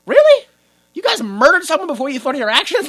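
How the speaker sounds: high, loud, laughing disbelief; incredulous scolding. Acted. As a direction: berate them in comic outrage. Mock scolding of the townsfolk.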